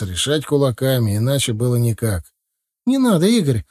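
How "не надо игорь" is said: high, loud cry of alarm; Masha's cry. A narrator's reading. Masha shouts at Igor to stop the fight, high frightened cry. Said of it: "крик Маши".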